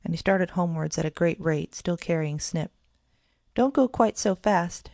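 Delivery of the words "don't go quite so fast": high, slow plea; pleading. A narrator's reading. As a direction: beg her brother to slow down. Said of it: "pleaded Kitty".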